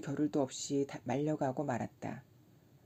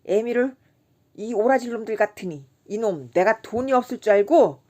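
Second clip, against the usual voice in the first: higher, loud furious outburst; sudden fury; 5 semitones higher, 9 dB louder than usual. A narrator's reading. Kim bursting out in rage at the staring boys. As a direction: explode with drunken rage. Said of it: "화를 버럭내며".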